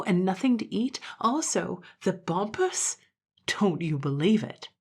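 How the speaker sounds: lively, complaining gentleman with rising exclamations; grumbling dandy. Acted. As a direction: play a put-out dandy protesting the summons.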